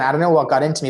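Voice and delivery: ordinary talk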